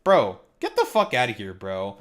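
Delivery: ordinary talk, nothing marked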